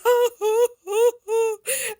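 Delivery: a high, giddy burst of laughter. Real, not acted. Laughing at her own cat confession. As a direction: let the laugh spill out.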